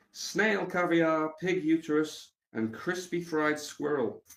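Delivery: drawn-out, savouring each dish; slow menu read. Real, not acted. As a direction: read the menu slowly in disbelief. Reading menu aloud.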